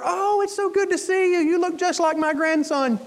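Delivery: high-pitched doting grandparent impression; mock sweet old-timer, delighted; delighted elderly patient. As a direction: coo in a high voice at a visitor.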